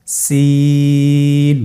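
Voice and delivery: সিন with the vowel held three counts, thin and light; Arabic letter lengthened for learners; three alif stretch. Shown as a demonstration. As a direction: draw সিন out long. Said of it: "আবার উচ্চারণ করুন".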